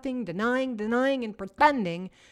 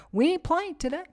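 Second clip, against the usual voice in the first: fired-up; 6 semitones higher than usual. Shown as a demonstration. Self pep talk.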